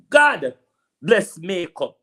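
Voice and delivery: God bless makeup shouted high and loud; a sudden mocking cry of thanks; exclaimed praise. Real, not acted. Ranting at Juliet Holness, loud mocking exclamation. Mocking rant about politician.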